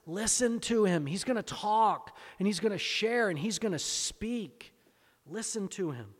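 high and loud, proclaiming the Father's command; preaching shout. Real, not acted. Preacher declaring God's command at full force. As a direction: cry it out over the whole church. Preacher's climax, voicing God.

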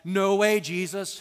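blunt protest. Retold or imitated. Peter refusing to let Jesus lay down his life, loud protest. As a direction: burst in loudly to object.

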